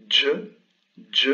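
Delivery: voiced "Dj", tongue stop then buzz; a crisp "Dj" as in Arabic; Arabic jim sound. Shown as a demonstration. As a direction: say "Dj" like the d-j in "jeans". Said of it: "le premier qui est assez facile".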